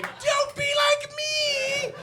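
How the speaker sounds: very high, slow, loud warning wail; shrill skeleton shriek. Acted. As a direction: wail it like a doomed villain. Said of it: "As if to say".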